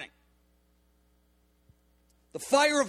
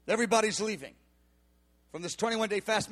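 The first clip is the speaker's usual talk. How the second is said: usual speaking voice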